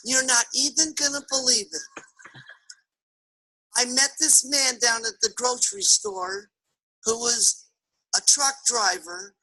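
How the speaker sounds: soft, hesitant explanation; lightly voiced timid wife, with pauses; meek wife. Wife confesses to husband about the man at the store, meekly.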